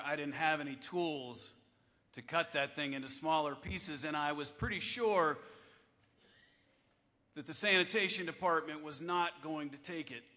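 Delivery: ordinary talk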